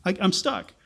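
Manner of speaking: high yelp of mock panic. Preacher voicing the trapped Israelites in mock panic. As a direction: play the panicked, stuck Israelite.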